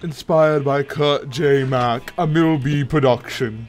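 slow, measured production credit; mock credits announcer. Co-host reads out a pretend production credit, slow announcer voice.

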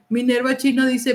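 usual speaking voice

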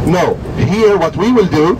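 usual speaking voice

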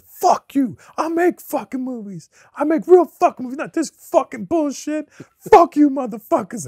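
hammed-up raging actor yelling at the set; furious rant. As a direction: blow up and storm off. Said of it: "he got really pissed and he stormed off".

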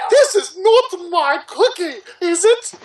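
high falsetto cartoon voice; hammed-up high questioning pony; squeaky pony line. Voicing character in fanfic.

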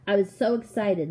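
ordinary delivery

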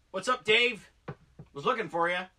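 greeting Dave from across the room, loud; calling out. Real, not acted. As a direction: call out to a friend nearby.

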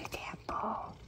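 whispered repeat to the cat. Real, not acted. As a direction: hush the line to the cat.

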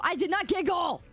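high, emphatic protest; squeaky denial. Real, not acted. Streamer answering whether they giggled, high emphatic denial.

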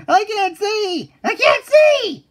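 high, slow desperate cries; panicked wail. Acted. Blinded, panicking.

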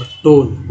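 "टोन" with a full long o; long O sound. Shown as a demonstration. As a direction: model "टोन" on a round o. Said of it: "ओ का उच्चारण ओ के रूप में".

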